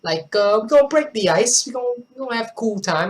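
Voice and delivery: playful demo of street-accent patter. Shown as a demonstration. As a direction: break the ice in a street accent. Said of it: "I pull off that street accent".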